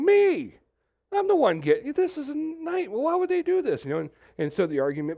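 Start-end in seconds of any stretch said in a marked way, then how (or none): 0.0-3.8 much higher, squeaky self-pity; self-pitying whine. Retold or imitated. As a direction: squeal it like the world revolves around you. Acting a self-consumed sufferer.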